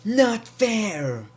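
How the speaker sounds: high, slow whiny brat voice, drawing out not fair; spoiled kid whine. Retold or imitated. Mocking Baron Corbin complaining about the match, whiny spoiled kid. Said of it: "he reminded me of a kid, spoiled kid".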